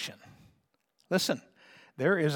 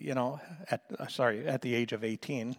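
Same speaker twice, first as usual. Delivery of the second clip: normal talking voice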